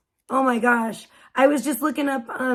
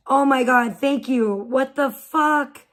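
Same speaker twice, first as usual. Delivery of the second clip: ordinary delivery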